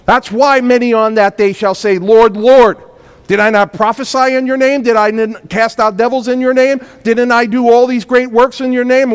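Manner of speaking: loud, high, driving sermon delivery; full-force preaching. Acted. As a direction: preach it out to the whole church.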